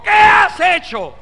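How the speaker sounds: high, slow, booming rebuke; angry prophet. Retold or imitated. Prophet Eliseo rebuking the king, slow furious shout. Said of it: "vino el profeta enojado y le dijo".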